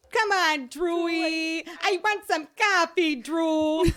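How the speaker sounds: high, loud, drawn-out whine of a plea; performed coffee plea. Shown as a demonstration. Performing for cousin Drew to make her laugh, high loud put-on plea. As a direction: beg loudly in a high put-on voice. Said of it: "And I would go".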